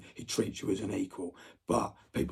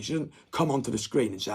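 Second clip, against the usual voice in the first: usual speaking voice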